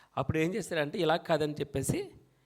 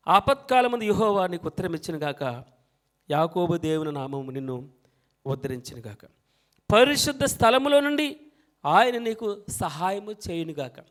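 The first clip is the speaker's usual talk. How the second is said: solemn psalm recitation; scripture reading; 7 dB louder than usual. Acted. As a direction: read it out like a verse from the pulpit. Reads the psalm aloud.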